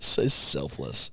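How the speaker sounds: deep, drawn-out sarcastic echo; low slow mock praise. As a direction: drop the voice and drag it out.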